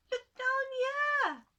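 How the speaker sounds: very high, loud and slow little-man voice; a tiny creature calling up from the ground; tiny man's voice. Acted. Tiny man calling up to the woman, very high and slow. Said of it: "said the voice".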